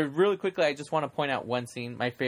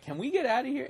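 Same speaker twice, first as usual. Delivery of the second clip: put-on much higher, pleading kid voice; bored kid; 12 semitones higher, faster than usual. Man-child begs his date to leave the fancy party, high and pleading. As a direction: whine it like a restless child.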